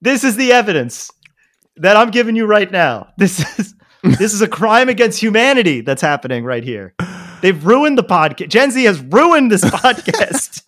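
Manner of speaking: mock prosecutor. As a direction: present the evidence like a courtroom drama. Mock trial about Gen Z.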